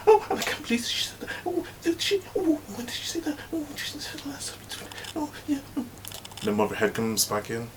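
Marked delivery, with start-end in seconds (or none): 0.1-5.6 very high squeaky mock gossip; catty gossip. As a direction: squeal and whisper snide remarks. Women gossip when she leaves.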